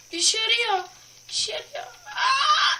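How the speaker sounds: high, loud thrilled cry; excited. Real, not acted. Gamer thrilled the trick is working. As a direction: burst out as it finally works.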